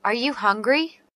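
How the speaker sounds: normal talking voice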